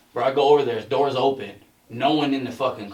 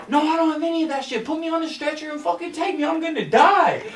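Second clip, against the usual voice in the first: imitating a mad friend, much higher and fast; getting mad; 13 semitones higher than usual. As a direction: snap back like a fed-up patient demanding the ambulance. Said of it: "He starts getting mad".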